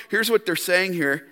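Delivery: ordinary talk, nothing marked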